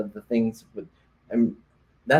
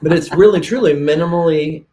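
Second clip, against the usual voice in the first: really, truly minimally said louder and higher; emphatic stress; 5 semitones higher, 8 dB louder than usual. Real, not acted. Host forcing out the word she stumbled over, emphatic. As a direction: push through truly minimally with force. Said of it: "but I can't say minimally".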